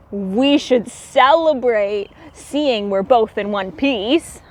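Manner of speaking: animated storybook narration; reading to children. A narrator's reading. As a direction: read it like a bedtime picture book. Reads picture book aloud.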